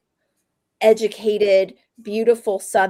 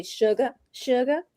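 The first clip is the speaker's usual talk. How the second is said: lightly imitated Charleston Southern accent, drawn out; slow Southern pronunciation, like a South Carolina grandmother; grandmother's Southern accent; 2 semitones higher than usual. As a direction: say it slowly in old-school Southern. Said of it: "the way she'd say".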